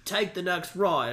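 hammed-up Australian accent, slightly British. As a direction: imitate an Australian satnav voice, drawn out. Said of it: "is an Australian accent".

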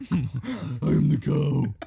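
loud put-on cow declaration. Echoing friend's cow bit, booming. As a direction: boom it out like a cow.

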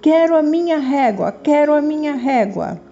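put-on high, insistent little girl voice; stubborn demanding girl. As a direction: refuse to back down, high and pushy. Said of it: "continuava irredutível".

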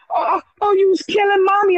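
put-on high, whimpering child voice; crying little boy. Father imitating his crying son, high and whimpering. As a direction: play the upset little son. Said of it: "He said".

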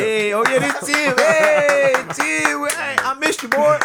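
loud, high hyped welcome; excited greeting. Pretend. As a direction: shout a happy hello to an old friend. Greeting Tim loudly.

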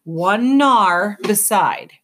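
slow, measured prophetic line; solemn quote. Acted. Solvet reciting the prophecy to Luxa. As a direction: intone it like scripture.

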